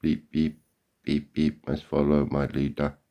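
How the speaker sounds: loud, mechanical beep-beep like a robot; mock robot beeping. Mocking golfers as robot sheep following the leader, loud mechanical beeps. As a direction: beep it out like a mindless machine following orders. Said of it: "Oh, no, they're not robots".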